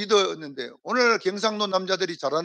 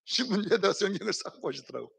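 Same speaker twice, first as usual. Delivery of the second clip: laughing through it, softer; 6 dB quieter than usual. Real, not acted. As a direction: laugh while retelling. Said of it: "repeated with a laugh". (웃으면서 말하듯)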